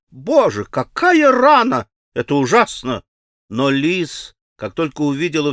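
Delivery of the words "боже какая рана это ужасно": frightened cry, high on какая рана; cried out. Acted. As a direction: gasp at the wound. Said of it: "вскрикнула".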